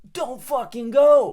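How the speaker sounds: exasperated scold; emphatic rant. Real, not acted. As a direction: stretch out "don't fucking go" like a scolding. Ranting about lazy interviews.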